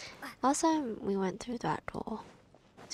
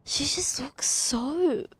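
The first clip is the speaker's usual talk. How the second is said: whispered awe; 5 semitones higher, 4 dB louder, much slower than usual. Real, not acted. Admiring the character's look.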